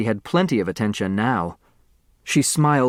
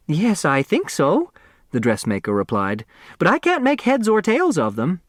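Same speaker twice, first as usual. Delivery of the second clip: lightly raised, fluttery dressmaker; 6 semitones higher than usual. A narrator's reading. Dressmaker answering the heirs, lightly raised worried voice.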